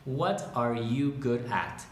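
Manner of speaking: slow, word-by-word question; slow dictation. Shown as a demonstration. Teacher dictating for volunteer transcribers, slow and spaced out. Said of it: "yo les voy diciendo".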